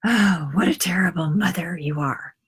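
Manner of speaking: put-on whispered disapproving old lady's voice; judging old lady. Mother imitating street ladies judging her, whispered and disapproving. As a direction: hiss out silent judgment like a tutting old woman. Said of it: "giving me this".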